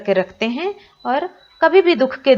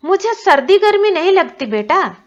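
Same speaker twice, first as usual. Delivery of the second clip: put-on higher, louder old granny waving it away; granny brushing off; 10 semitones higher, 5 dB louder than usual. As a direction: dismiss the worry as granny. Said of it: "दादी माँ बोल रही हैं".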